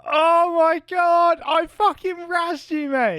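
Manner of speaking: high, slow triumphant shout; gloating. Real, not acted. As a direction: gloat over the win. Prankster gloats loudly.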